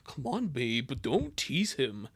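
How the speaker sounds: put-on low, easygoing boyfriend voice, slowing at the end; Bobby. Boyfriend asking Lori to stop teasing, low voice. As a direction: drop down into a boyfriend's voice. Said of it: "sorry, that's Bobby".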